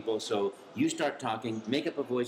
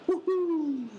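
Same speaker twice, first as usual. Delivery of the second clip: gleeful cheer; 4 dB louder than usual. Retold or imitated. Miyamoto hears the tape.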